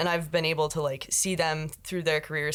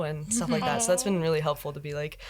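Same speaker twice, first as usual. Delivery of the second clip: ordinary talk, nothing marked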